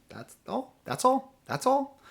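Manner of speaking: high-pitched, rising little disclaimer; mock-innocent protest. Real, not acted. Mock-innocent defence of jab at owners.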